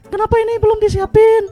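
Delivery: very high-pitched mimicry of a scolding neighbour; put-on falsetto nagging; complaining neighbour impression. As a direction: squeal it out like a fed-up neighbour nagging at home. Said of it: "Nadanya mirip lah, naik turunnya".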